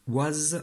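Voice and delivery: "was" ending in a buzzed z; voiced ending. Shown as a demonstration. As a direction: say "was" as waz, z clearly voiced. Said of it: "c'est le son ze".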